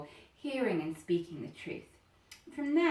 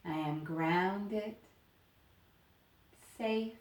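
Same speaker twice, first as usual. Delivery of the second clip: slow affirmation; slower than usual. Acted. Teacher giving students an affirmation to repeat, slow and soothing. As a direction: let each word land, then rest. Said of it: "thinking to yourself".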